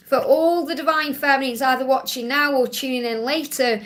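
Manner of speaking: normal talking voice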